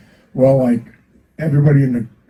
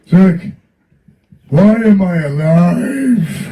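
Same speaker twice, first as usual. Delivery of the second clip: higher, louder, slow mock [name] with a laugh; slow [name] impression, higher and louder; imitating [name]; 7 semitones higher, 5 dB louder, much slower than usual. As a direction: stretch it out like a deadpan [name], breaking into laughter. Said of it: "he looked at me and he goes".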